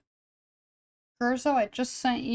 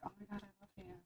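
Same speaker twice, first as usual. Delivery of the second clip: much softer, breathless mock adoration; hushed gushing; 26 dB quieter, much faster than usual. Artist replaying her reaction to a paying client, hushed adoring. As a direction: murmur it like a secret crush. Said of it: "And I'm like".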